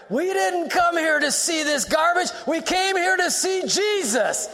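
indignant shout in a dark theater; angry shout. Retold or imitated. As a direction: shout down the filthy previews. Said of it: "I said with a loud voice in the theater".

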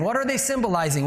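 ordinary delivery

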